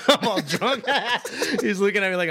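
high laughter breaking through; laughing. Real, not acted. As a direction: laugh hard, the voice jumping up high. Laughing at own drunk story.

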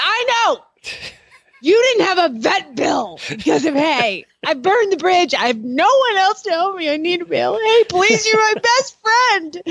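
high, wailing mock sob; fake crying. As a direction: plead in a hammed-up teary wail. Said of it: "being able to cry on demand".

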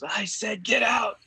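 sudden high shout of get out; Kreese shouting. Retold or imitated. Sensei throwing a student out, shouting. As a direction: bark the order, rising to a yell. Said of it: "Kreese goes".

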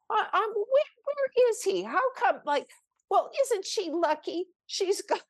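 high-pitched hurt indignation with a laugh; self-pitying complaint. Retold or imitated. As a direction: complain like you've been left out. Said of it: "I think to myself".

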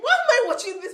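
laughing high quote; high amused voice. Retold or imitated. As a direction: squeak it out laughing. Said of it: "And I was like".

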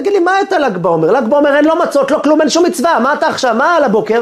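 high, exasperated rant; indignant rejection. Retold or imitated. Rabbi dismissing the holiday visit, indignant rejection. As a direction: shoot the idea down in irritation. Acted irritated rejection of request.

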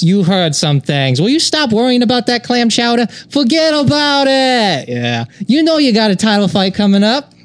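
put-on high, tough-guy Boston accent; Boston Joe Pesci. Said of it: "I'm gonna be a Boston Joe Pesci".

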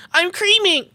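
high-pitched comic cry; high mock-excited yelp; mock outburst. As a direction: blurt it out high, as if overheard. Acting out being overheard in office.

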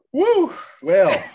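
usual speaking voice

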